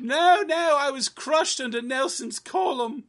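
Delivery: high, hammed-up ghostly lament. Ghost of Chip telling Gary how he died, high and mournful. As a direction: moan it like a wronged spirit.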